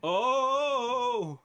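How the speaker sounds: loud squealed surprise; high mock "Oh!". Reacting to their O face.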